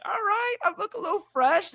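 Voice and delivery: high, preening voice to the mirror; guy checking his fresh eyebrows in the mirror; pleased with himself. Retold or imitated. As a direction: admire yourself in a put-on squeaky voice. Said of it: "you may look at yourself like".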